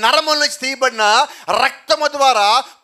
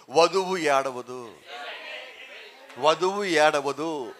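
slow, lower, weighty and deliberate; a solemn, slowed-down pronouncement; slow low declaration; 8 semitones lower, 8 dB quieter, much slower than usual. Acted. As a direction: let each word land heavily and slowly. Preacher's emphatic repeated declaration.

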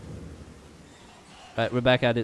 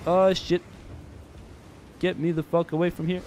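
loud panicked swearing; startled panic; 7 semitones higher, 5 dB louder than usual. Real, not acted. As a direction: yelp it in fright. Startled by enemy.

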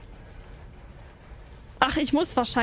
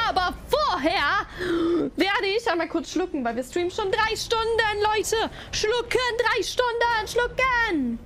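high sing-song; 7 semitones higher than usual. Acted.

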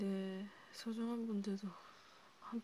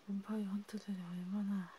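whisper. Acted. Warning fellow vampires about the hunters, whispering. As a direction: whisper it like a grim secret.

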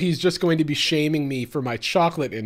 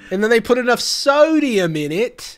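copying the clip line by line in a mock Australian accent, higher and louder; parroting "sodium" Aussie-style, pitched up; 8 semitones higher, 7 dB louder than usual. YouTuber echoing the TikToker word for word, mock Australian accent. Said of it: "now I'm just working on my Australian accent".

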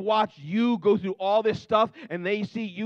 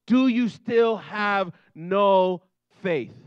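every word of "Do you still have no faith" drawn out and pressed hard; with emphasis; 5 dB louder, much slower than usual. Shown as a demonstration. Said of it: "Do it with emphasis".

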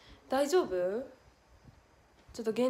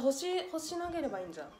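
usual speaking voice

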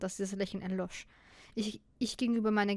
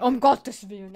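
shocked exclamation; 10 dB louder, slower than usual. Acted. Shock at giant banana parfait.

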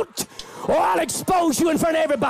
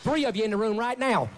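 put-on Southern preacher accent; much faster than usual. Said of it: "I could do that accent forever".

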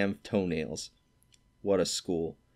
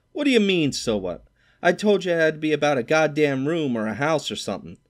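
much higher, louder indignant yell; angry retort; 8 semitones higher, 6 dB louder than usual. A narrator's reading. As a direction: shout him down in outrage. Stradlater angry at Holden.

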